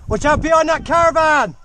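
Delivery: high loud warning yell; shouted warning. Real, not acted. Warning teammate across the field.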